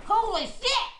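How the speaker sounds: shocked yelp. Real, not acted. First bite of very hot sauce.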